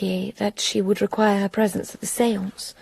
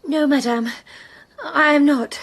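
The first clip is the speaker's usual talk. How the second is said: higher, trembling, tearful reply; frightened denial; 5 semitones higher than usual. Acted. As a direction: hold back tears as you deny it. Célie frightened but defiant.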